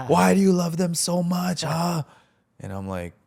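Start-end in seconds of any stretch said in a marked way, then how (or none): 0.1-2.0 high, loud mock yell; playful re-enactment of being yelled at; imitated yelling. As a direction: act out an exasperated pastor yelling a question. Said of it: "I remember him yelling at me".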